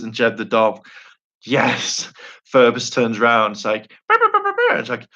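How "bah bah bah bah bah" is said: rapid string of squealed nonsense syllables; high mock bleating. Ferbus turns around, babbling in a high put-on voice. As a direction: babble high like a cartoon creature.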